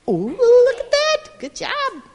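ordinary delivery

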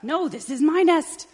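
high, loud territorial protest; quarrelling chicken. Acted. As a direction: shout back, claiming it as yours. Chicken fights duck for nest.

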